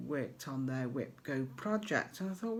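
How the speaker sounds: ordinary delivery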